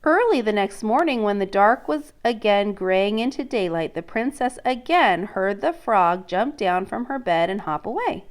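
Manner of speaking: reading a picture book to a class; storybook read-aloud voice; story narration. Acted. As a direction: let the princess's dread come through. Teacher reads story aloud.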